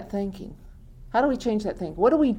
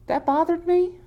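incredulous; 9 semitones higher than usual. Acted. Acts out later disbelief at worry.

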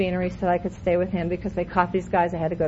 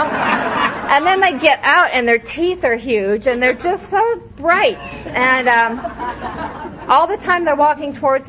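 mock awe; 4 semitones higher, 3 dB louder, slower than usual. Mocking the beaming newcomers.

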